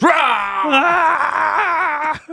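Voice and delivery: high shouted jump-scare roar; monster roar. Retold or imitated. The nun jumping out at Burke, shouted roar. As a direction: leap out with a yell. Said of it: "and she goes".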